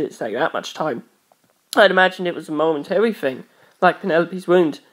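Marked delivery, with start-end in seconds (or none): none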